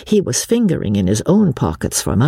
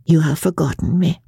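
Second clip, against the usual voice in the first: higher, wounded foreign lady; mildly sad reproach; 6 semitones higher than usual. A narrator's reading. Unmasked lady tells Joss he forgot her, mildly hurt. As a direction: reproach him with a touch of sorrow.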